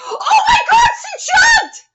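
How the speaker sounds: high, loud burst of shock; horrified shriek. Real, not acted. Viewer watches heroine jump, horrified shriek. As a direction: scream it in disbelief.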